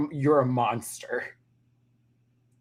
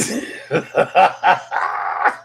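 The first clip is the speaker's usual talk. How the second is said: loud, helpless cackle after a bit; loud laugh; 4 semitones higher, 7 dB louder than usual. Real, not acted. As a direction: burst out cackling. Cracking up at own impression.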